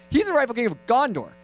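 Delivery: mock over-keen uncle; insistent repeat. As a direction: drive the point home to a child.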